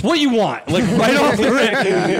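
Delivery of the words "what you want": donut lady voice. Retold or imitated. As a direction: bark the order like a brusque shopkeeper. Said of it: "And she goes".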